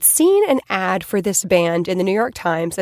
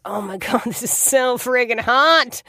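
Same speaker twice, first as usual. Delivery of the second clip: retold swooning; 4 semitones higher than usual. Woman hearing her crush used to fight, smitten and gushing. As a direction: gush it, drawing out the admiration. Swooning at his goon confession.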